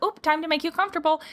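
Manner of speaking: high, quick sing-song reassurance; fussing over horse. Retold or imitated. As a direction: hurry in to comfort an uneasy horse. Acted instinct to soothe uneasy horse.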